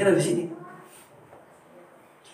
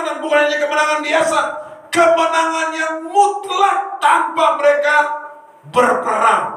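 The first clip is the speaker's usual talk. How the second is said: fervent sermon delivery, pitched high; preaching at full force; 13 semitones higher, 4 dB louder than usual. Acted. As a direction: proclaim it to the whole church. Preacher climax of story.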